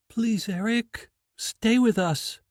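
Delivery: put-on a girl begging her dying friend to stay; pleading. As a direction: beg him not to die.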